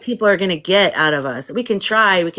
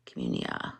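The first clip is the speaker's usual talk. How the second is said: breathy whisper of the app name Communia; 15 dB quieter than usual. Shown as a demonstration. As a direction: whisper the word Communia softly. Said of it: "called Communia".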